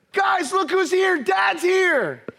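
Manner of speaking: high, stretched-out excited call; thrilled child. Acted. Son announcing to the family that dad is home, thrilled. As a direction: call the whole house like an overjoyed kid.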